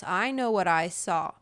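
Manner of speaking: normal talking voice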